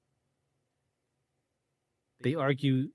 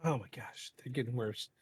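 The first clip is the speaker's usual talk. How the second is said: quiet, hiccuping asides; half-muttered with hiccups; 9 dB quieter than usual. Real, not acted. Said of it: "These hiccups".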